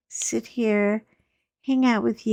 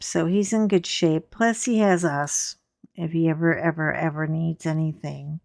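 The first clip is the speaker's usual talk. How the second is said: ordinary talk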